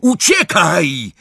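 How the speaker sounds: high, drawn-out cry; shouted warning. A narrator's reading. Warning friends of the fire, shouted. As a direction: call out a warning, stretching it.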